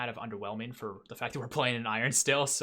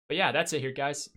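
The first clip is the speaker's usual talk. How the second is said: loud, raised sign-off with a crack; 4 semitones higher, 7 dB louder than usual. Real, not acted. YouTuber signing off to viewers, voice cracking. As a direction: let the voice crack as you wrap up. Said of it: "my voice just cracked there".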